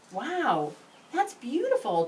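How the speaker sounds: put-on high, bright child wonder; amazed. A narrator's reading. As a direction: gasp it out in delight. Boy admiring the stamp.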